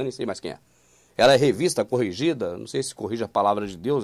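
ordinary talk

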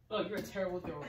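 ordinary talk, nothing marked

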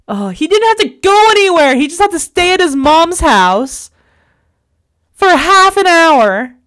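exasperated outburst. Real, not acted. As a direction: let the fury spill out at him. Venting fury at boyfriend.

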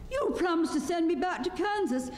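put-on high-pitched girl pressing her claim; insistent girl. A narrator's reading. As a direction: remind them of a promise in a high voice. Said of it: "said the girl".

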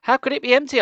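high, loud demanding question. Acted. Gabe demands of Uncle Ben, high and loud. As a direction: push back hard, disbelieving. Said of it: "I demanded".

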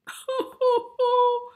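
high "hoo-hoo" fake sobs; boo-hoo like a weeping cow; mock weeping. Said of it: "The cow began to weep".

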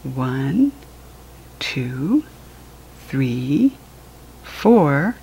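ordinary delivery

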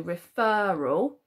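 "referral" slowed, with the middle "er" drawn out; stress on the FER. Shown as a demonstration. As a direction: lean long on the middle syllable of "referral". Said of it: "need that to sound like an er".

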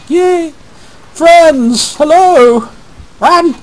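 high, loud cartoon squeal; squeaky cheering. Cheering silly high voice in game.

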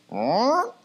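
high rising startled "huh"; mock shocked reaction. Parent reacts to learning the coach is a pastor, mock shocked. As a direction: imitate a room suddenly taken aback.

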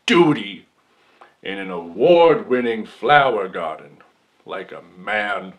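loud, theatrical courtroom outrage; mock indignant outburst. Accused man challenging the jury over the flower garden, loudly indignant.

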